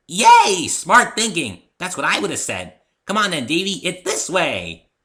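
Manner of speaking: very high and loud, thrilled; cheering Pinky. A narrator's reading. Pinky cheers the narrator's plan, very high and loud. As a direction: squeal with delight and bounce off.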